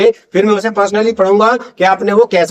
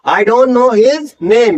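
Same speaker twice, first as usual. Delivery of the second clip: know said very slowly, k silent; stretched word by word; slow careful demo; much slower than usual. Shown as a demonstration. Teacher models silent k, very slowly. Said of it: "simply बोलेंगे".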